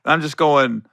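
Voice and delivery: ordinary talk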